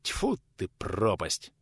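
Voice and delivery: spitting out a muted curse; exasperated curse. A narrator's reading. Visitor curses at the women's answer, exasperated. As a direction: curse in vexation, under the voice. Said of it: "в сердцах выругался пришелец".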